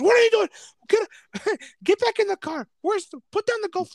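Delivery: high-pitched, loud acted order; alarmed yelling. Officer to man out of the car with a golf club, alarmed yelling. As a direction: yell it in a panicked high voice.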